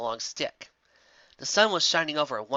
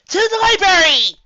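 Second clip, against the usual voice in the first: high, loud rallying shout; triumphant cry; 14 dB louder than usual. A narrator's reading. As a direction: proclaim it like a battle cry. Rearing up, triumphant.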